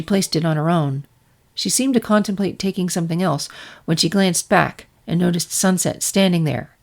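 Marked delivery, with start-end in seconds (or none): none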